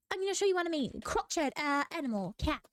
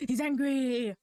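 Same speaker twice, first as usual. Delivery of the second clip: louder, laughing as she says it; 4 dB louder, slower than usual. Real, not acted. Laughing at her drawing to viewers, loud and amused. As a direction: crack up at the angry duck.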